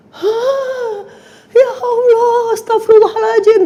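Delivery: high, loud wailing cry to God. Retold or imitated. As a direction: cry out in anguish. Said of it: "Teriak-teriak saya sampai ke bawah-bawah, nangis". (teriakan meratap bernada tinggi)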